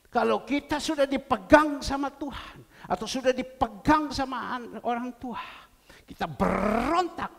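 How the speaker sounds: loud, high preaching climax; fervent preaching. Acted. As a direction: drive the warning home at full force. Preaching at full force.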